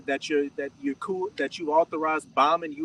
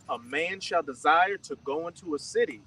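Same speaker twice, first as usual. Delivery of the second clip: slow, measured Bible verse reading; scripture reading; slower than usual. Acted. Reader giving verse seventeen to the teacher, measured scripture reading. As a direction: read the verse out deliberately, word by word. Reads scripture aloud to group.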